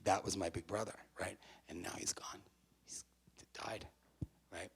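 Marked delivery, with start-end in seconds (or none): 1.7-4.7 whispered, barely there; hushed grief. Real, not acted. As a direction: breathe it out as a whisper of loss. Grieving over brother's death.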